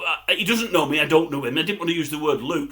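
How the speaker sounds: ordinary delivery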